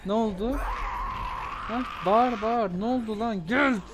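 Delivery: high, loud come-here challenge; taunting shout. Real, not acted. Gamer yelling taunts at zombies. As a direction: bellow at them to come get some.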